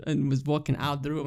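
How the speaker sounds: ordinary talk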